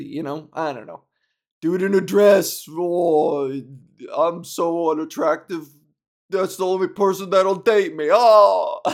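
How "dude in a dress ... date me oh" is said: loud sneering mock of a teaser; hammed-up jeering caricature; loud mocking parody of a bully.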